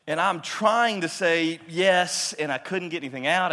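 fighting back tears as he recalls it; emotional catch in the voice; choked with emotion. Real, not acted. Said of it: "I just bubble over with emotion".

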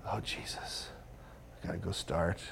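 hushed dread, starting in a whisper; low, soft anxious muttering; daunted self-talk. Retold or imitated. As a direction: worry to yourself under your breath. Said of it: "I'm just like".

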